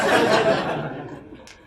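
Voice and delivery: a big, bright laugh breaking into the thanks; loud burst of laughter. Real, not acted. Host laughing while thanking the international audience, loud laugh. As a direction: laugh out loud, caught off guard.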